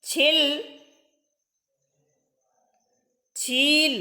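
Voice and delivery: छिल quick, छील with the ई held longer; short then long vowel. Shown as a demonstration. Teacher contrasting short and long vowels. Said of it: "ई का उच्चारण में अधिक समय लगता है".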